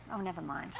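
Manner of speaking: normal talking voice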